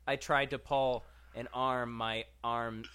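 ordinary delivery

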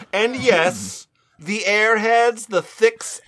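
normal talking voice

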